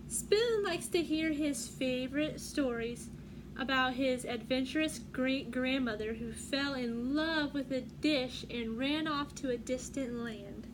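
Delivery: ordinary delivery, nothing marked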